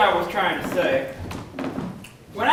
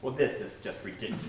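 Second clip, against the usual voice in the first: ordinary delivery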